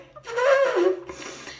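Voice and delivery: real, high laugh that bursts out; a high giggle burst; high burst of laughter. Real, not acted. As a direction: crack up helplessly. Kid asks what's so funny.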